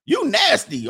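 high, loud mock disapproval; playfully scandalized. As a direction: tease it out in pretend shock. Teases the imagined lewd woman.

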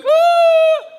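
sudden high shriek of panic; mock scream of fear at sea; panicked scream. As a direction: scream like a terrified fisherman. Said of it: "They're not going".

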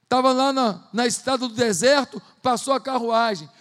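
normal talking voice

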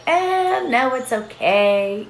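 mock demurring, high drawn-out start then slow; reluctant refusal. People declining help when asked, drawn-out mock demurral. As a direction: imitate someone politely waving help away, stretched out.

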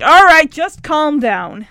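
slow, loud, steadying words; firm calming. Retold or imitated. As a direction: talk him down slowly and firmly. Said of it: "And he's like".

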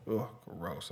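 soft disgusted groan; a quiet grossed-out ugh; annoyed ugh. Real, not acted. Disgust at adult posers.

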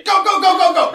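high, loud egging-on; rapid-fire high cheering command; acted urging command. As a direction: send an attack dog off excitedly. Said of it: "richt hem gewoon aan de andere kant en zeg".

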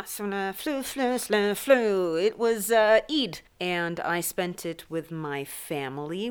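usual speaking voice

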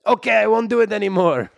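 usual speaking voice

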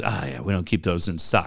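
lightly voiced bored service guy; dealer guy. Retelling the dealership guy's reply, low and offhand. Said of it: "Guy goes".

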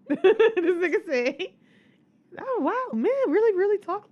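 high laughing voice over the words; laughing read. Real, not acted. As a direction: giggle through the reading. Reading text while cracking up.

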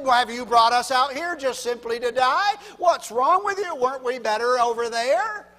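loud, indignant mock grumbling; complaining. Israelites in the wilderness complaining about being brought out, loud complaint. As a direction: protest as an aggrieved crowd. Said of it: "they murmured and they complained".

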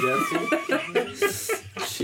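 high, slow laughing question. Real, not acted. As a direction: laugh the words out.

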